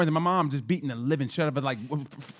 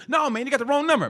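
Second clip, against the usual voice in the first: much higher, brushing off the caller; dad on the phone; 10 semitones higher, 4 dB louder than usual. Retold or imitated. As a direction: wave off the caller mid-fight. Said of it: "he goes".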